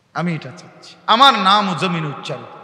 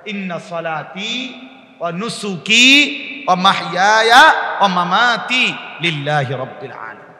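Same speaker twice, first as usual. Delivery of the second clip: measured Quranic quotation with lengthened syllables; Quran recitation; 5 dB louder than usual. Real, not acted. Quotes Quran verse in Arabic.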